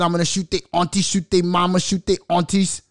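imitating [name].

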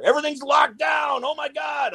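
high shouted mock panic; mock alarm. Man retelling lockdown news, mock panicked shout. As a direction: yell it like the world just ended.

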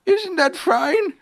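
high, slow, stretched-out call; Pooh shouting down. A narrator's reading. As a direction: call it down proudly in a high bear voice. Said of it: "shouted Winnie the Pooh down to you".

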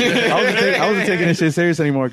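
cracking up mid-sentence, high and loud; real laughter pushing the words high; laughing. Real, not acted. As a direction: talk through a burst of laughter. Cracking up while telling story.

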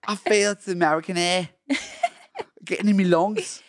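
put-on amateur Australian tourist accent. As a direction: put on a loose Aussie accent. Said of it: "we're Australian tourists".

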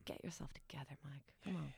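low, soft talking-to to yourself; little pep talk. Retold or imitated. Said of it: "a little pep talk".

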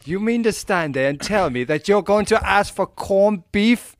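high, loud, slow mock outrage; hammed-up Irish disbelief; indignant Irish local. Irish local scolding an American, loud slow mock outrage.

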